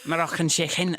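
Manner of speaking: "Moroccan chicken" with a Scouse throaty ch, "chicken" as "chixin"; Scouse test phrase. Shown as a demonstration. Practising Scouse on the test phrase, throaty k. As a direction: say "Moroccan chicken" the Liverpool way, scraping the k. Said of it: "to practice your Scouse accent".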